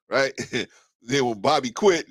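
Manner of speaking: normal talking voice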